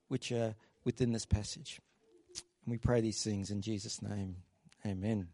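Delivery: a hushed closing prayer. Acted. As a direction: pray sincerely for the congregation. Leads the congregation in prayer.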